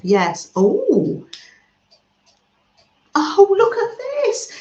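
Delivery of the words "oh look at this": high, loud cry of delight; delighted exclamation. Real, not acted. Thrilled host seeing a gift from viewers, loud and high. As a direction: squeal with joy at the gift.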